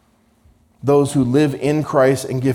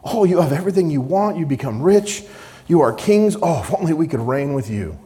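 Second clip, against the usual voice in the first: high, fast mocking "Oh"; sarcastic; 7 semitones higher, faster than usual. Paul mocking the Corinthians' boasting, sarcastic. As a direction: paraphrase it with biting scorn. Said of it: "Paul's words in verse eight are sarcasm".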